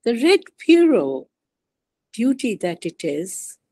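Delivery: slow, measured poem recital with pauses between phrases; poetry reading. Acted. Poet reading her poem Afterlife to fellow poets, measured recital. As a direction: read it line by line, letting each phrase fall. Reads own poem aloud.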